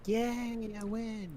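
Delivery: high-pitched imitated cheer; mock triumph. Girlfriend told she wins the fight, mock triumphant squeal. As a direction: imitate a girlfriend's gleeful little victory. Mimics girlfriend winning the argument.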